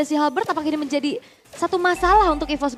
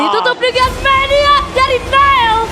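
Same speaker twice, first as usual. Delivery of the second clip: excited caster call; 6 semitones higher, 5 dB louder, slower than usual. Real, not acted. Co-caster shouts the game end.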